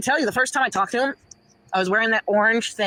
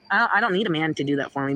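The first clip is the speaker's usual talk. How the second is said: ordinary talk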